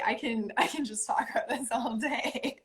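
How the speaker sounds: giggling through the words; laughing. Real, not acted. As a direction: laugh as you say it. Laughs while speaking.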